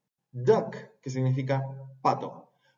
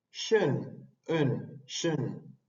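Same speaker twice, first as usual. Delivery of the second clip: normal talking voice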